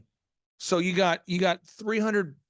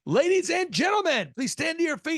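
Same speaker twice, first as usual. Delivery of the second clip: a DJ on the mic at a wedding, announcing grandly; announcer voice; 11 semitones higher than usual. Retold or imitated. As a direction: imitate a DJ's grand intro. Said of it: "he'd get on the mic and he'd say".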